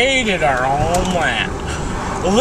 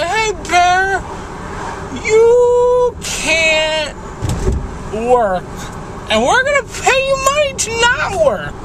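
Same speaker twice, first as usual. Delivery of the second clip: squeaky, much higher mocking voice; mocking official voice; 10 semitones higher than usual. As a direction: sneer it like a smug official.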